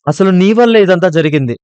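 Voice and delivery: put-on girl shouting blame at her friend. A narrator's reading. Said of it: "రూప గట్టిగా అరవడంతో".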